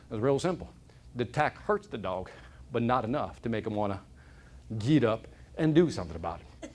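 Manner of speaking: a hint of a lazy old farmer; lightly voiced old man. Old man explains the tack.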